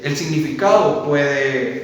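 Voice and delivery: usual speaking voice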